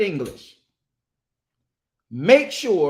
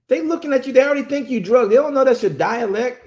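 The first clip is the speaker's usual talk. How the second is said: raised voice; 6 semitones higher, 5 dB louder, faster than usual. Real, not acted. Heated warning to parents.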